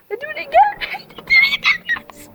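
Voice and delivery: very high, giddy squeal; giddy excitement. Real, not acted. Telling lads the fireworks are starting again, giddy excitement.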